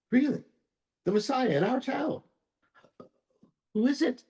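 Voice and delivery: can't believe the Messiah lives there; astonished disbelief. Acted. Man astonished by the news.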